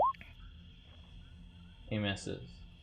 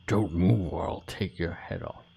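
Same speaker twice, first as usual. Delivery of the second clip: gruff whispered threat; 4 dB louder than usual. Acted. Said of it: "A voice whispers in your ear".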